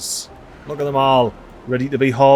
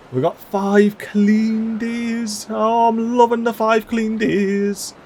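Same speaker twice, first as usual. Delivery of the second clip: delighted, drawn out; 6 semitones higher, much slower than usual. Real, not acted. Delighted forecast.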